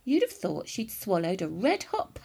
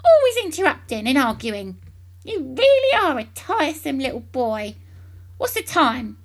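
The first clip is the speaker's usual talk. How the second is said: louder, higher nagging scold; loud crabby old woman; scolding grandma; 6 semitones higher, 8 dB louder than usual. A narrator's reading. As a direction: berate the tiresome boy.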